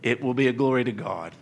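ordinary talk

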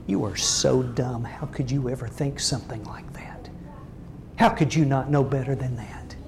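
low, contemptuous whisper; belittling tone. Retold or imitated. Spouse talking down to family, hushed contempt. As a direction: sneer it quietly, belittling. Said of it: "in a tone of voice that says".

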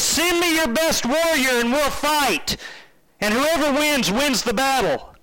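hammed-up giant calling across the valley; giant's yelled challenge. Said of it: "he yells at Israel's army".

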